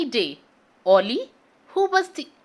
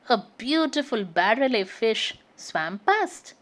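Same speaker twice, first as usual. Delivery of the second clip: plain reading voice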